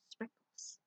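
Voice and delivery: breathy secret whisper of the word; whispered reveal. Acted. Teacher whispering the answer to children. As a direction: hush it like a secret surprise.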